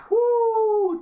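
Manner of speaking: sarcastic high whoop; a loud, high, fake celebration; mock cheer. Real, not acted. Mocking her promise with a high woo.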